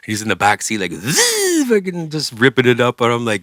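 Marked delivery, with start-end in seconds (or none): 0.9-1.7 high zzz buzz; buzzing mouth noise. Retold or imitated. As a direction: imitate a spinning top. Imitated ripping sound.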